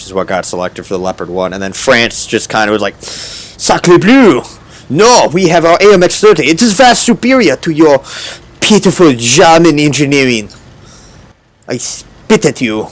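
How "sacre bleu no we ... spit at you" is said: put-on hammy high French accent, haughty. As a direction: scoff in an overdone French accent. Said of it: "is that a really bad impression".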